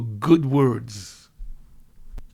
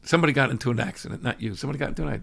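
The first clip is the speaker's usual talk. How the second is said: ordinary talk, nothing marked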